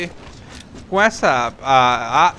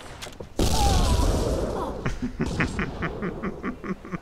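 laughing; 8 semitones higher, 5 dB quieter than usual. Real, not acted. Laughs at the grenade kill.

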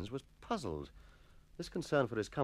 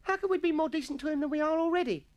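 put-on very high, indignant boy voice; protesting schoolboy; 4 dB louder than usual. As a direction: challenge it in a piping voice. Atkinson challenges the proposal.

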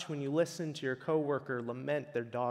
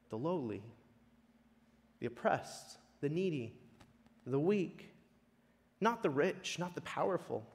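usual speaking voice

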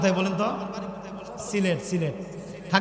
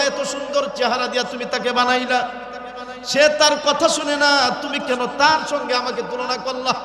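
higher, fervent preaching pitch; জোরালো ওয়াজের সুর; 6 semitones higher, 5 dB louder than usual. Acted. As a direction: preach it with rising fervor. Preaching at full force. (উঁচু গলায় আবেগী ওয়াজ)

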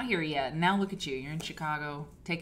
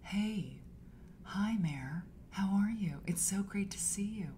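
lightly voiced soft-spoken aunt; Aunt Lisa; 5 dB quieter than usual. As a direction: gently imitate a favourite auntie's greeting. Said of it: "she talks like this".

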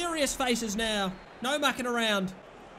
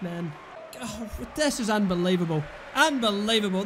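ordinary talk

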